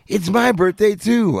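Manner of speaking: hammed-up whiny kid; lightly voiced cocky nineteen-year-old; mock kid's voice. Imagined kid's reply.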